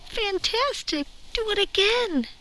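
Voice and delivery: high, loud cheering shout; excited shout. Acted. Princess shouting at the tired dragon to do it again. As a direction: egg the dragon on to fly again. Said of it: "but Elizabeth shouted".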